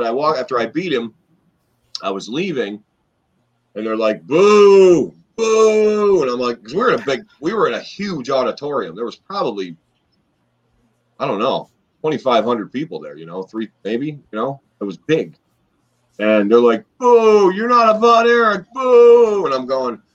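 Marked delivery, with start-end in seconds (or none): 4.2-6.2 long, high, loud boos; mimicked crowd booing. Retelling crowd booing him after match. 17.0-19.5 high-pitched, loud jeer with boos; mimicked crowd heckling. Retelling crowd heckling him over name.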